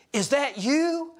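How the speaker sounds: put-on the old Sunday school teacher spotting him and asking, surprised; surprised greeting. Teacher recognizes a former student, high surprised question. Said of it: "and she says".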